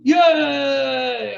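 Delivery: long, falling happy yay; bright little victory whoop; playful cheer. Real, not acted. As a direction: cheer yourself, stretched out. Cheers own first place.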